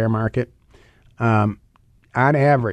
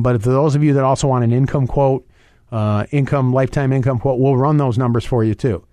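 usual speaking voice

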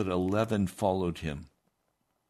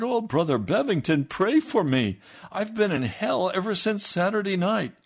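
higher, louder begging for prayer; desperate plea; 8 semitones higher, 4 dB louder than usual. Retold or imitated. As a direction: beg like a man in torment. Desperate man begs for prayer.

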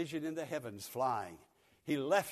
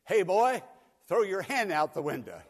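loud, higher mock yell; misheard shout; 6 semitones higher, 11 dB louder than usual. Boy recalls hearing driver yell, loud higher mock shout. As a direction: replay the driver's order as misheard. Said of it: "I thought for sure he said".

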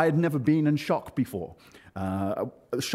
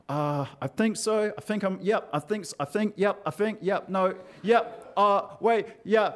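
higher, stop-start mock confusion; muddled hesitation; 7 semitones higher than usual. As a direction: act out dithering, losing the thread mid-answer. Muddled answer about thinking clearly.

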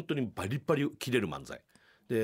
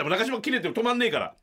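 usual speaking voice

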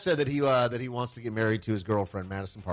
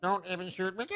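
parrot voice; 6 semitones higher, faster than usual. Shown as a demonstration. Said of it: "How do you do it in a parrot voice".

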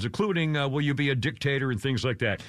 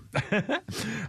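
high laugh; 7 semitones higher than usual. Real, not acted. Laughs at the forgotten topic.

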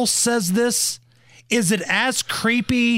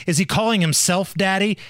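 normal talking voice

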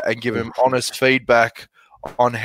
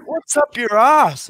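annoyed wife voice; 11 semitones higher, slower than usual. Retold or imitated. Wife snapping at grumpy husband.

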